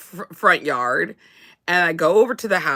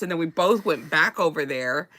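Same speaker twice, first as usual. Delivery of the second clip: ordinary talk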